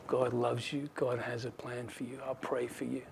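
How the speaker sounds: soft, low and gentle; smiling reassurance. Acted. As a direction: reassure her quietly, with a smile. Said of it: "he just smiles and says".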